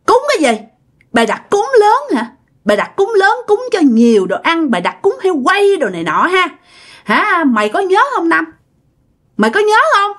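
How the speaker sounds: high-pitched accusing rebuke; indignant reproach. Acted. Hồn người cha trách con.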